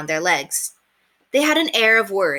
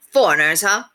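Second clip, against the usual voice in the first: loud, scoffing street fellow; scornful tout; 6 dB louder than usual. Acted. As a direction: scoff at the foreigners. Ticket man voiced.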